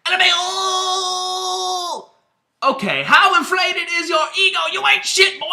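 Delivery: high, loud mocking shout of a dumb rival; squealing mock shout at a rival. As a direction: sneer it out in a high yell. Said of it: "And then he goes".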